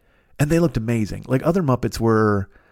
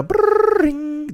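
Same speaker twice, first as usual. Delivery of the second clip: put-on high rolled brrrring like an old phone; phone ring imitation; 13 semitones higher than usual. Imitating an old phone ringing, high rolled trill. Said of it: "that's how a phone goes".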